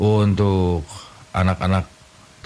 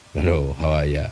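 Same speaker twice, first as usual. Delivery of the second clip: ordinary talk, nothing marked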